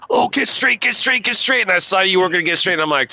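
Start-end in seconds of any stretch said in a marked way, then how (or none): 0.1-1.6 high, pleading chant to the other car; urgent pleading. Retold or imitated. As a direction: beg the car to straighten out. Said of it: "I was like".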